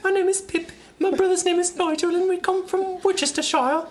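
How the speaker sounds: put-on very high childlike British voice; little English boy. Introducing himself as a little English boy. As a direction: pipe up like a small orphan. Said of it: "even younger".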